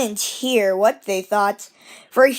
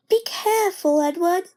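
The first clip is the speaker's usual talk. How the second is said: gentle coaches; 7 semitones higher than usual. Acted.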